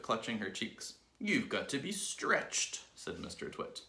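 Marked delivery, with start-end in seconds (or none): 1.2-2.8 higher, slow and drawn out; ominous verdict. A narrator's reading. Husband announcing cure to wife, slow and ominous. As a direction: stretch out the final word with relish.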